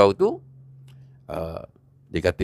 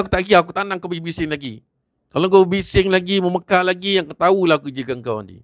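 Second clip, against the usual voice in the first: higher, fast threatening outburst; fast angry threat; 7 semitones higher, faster than usual. Acted. A young man threatens the villagers, fast and angry. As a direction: warn him off in a rush of anger.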